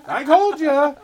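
high, loud gloating cry; hammed-up scream of vindication; mock scream. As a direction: scream it like a fan proved right. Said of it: "Brooke is gonna be screaming".

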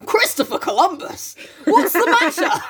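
high, exclaiming storybook astonishment; mock alarm. Jo crying out on finding her sister, hammed-up alarm.

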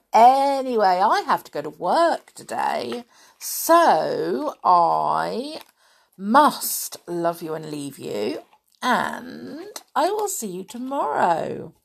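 ordinary delivery

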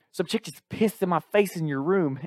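put-on high southern accent, lisping outrage; J B, lisping Southerner. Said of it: "had this southern accent with a lisp".

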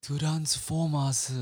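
Transformers, slow with extra syllables, Japanese accent. Shown as a demonstration. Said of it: "in a Japanese accent?".

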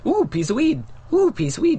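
high, playful put-on Irish voice for "Ooh, piece of weed"; jokey Irish accent; mock Irish accent. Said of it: "I'll let you do the Irish accent".

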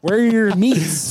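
high, slow goofy demand. Pretend. Goofy quote of meat question.

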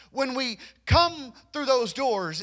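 normal talking voice

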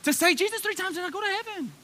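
high, put-on simpleton answer; mocking naive believer. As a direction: parody a glib answer in a high voice. Mimics a shallow believer's answer.

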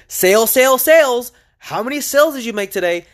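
mock his mom teasing him cheerfully. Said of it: "in a cheerful voice, uh, almost mockingly".

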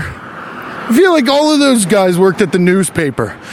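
usual speaking voice

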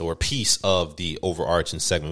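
usual speaking voice

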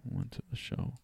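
mock monotone storytelling; monotone flat delivery. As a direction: drone it out like the boring lecturer. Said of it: "Dude, show some fucking emotion".

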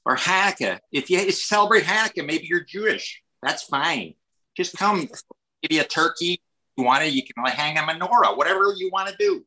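high-pitched put-on Chicago accent; hammed-up Midwestern accent, lilting high. Shown as a demonstration. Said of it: "Midwest here is sort of like".

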